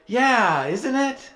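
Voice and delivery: high, loud, mock-proud with a laugh; milk the moment, half laughing; hammed-up smug agreement. His own hammed-up agreement.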